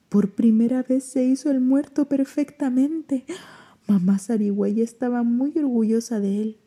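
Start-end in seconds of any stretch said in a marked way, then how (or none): none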